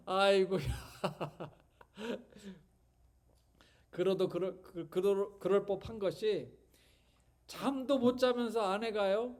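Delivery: ordinary delivery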